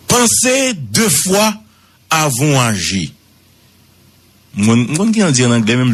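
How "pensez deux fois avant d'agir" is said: very high and slow, spelled out like a maxim; proverbe déclamé. Shown as a demonstration. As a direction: lift the voice high and draw out "Pensez deux fois". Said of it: "me dit en créole". (phrase haute et lente)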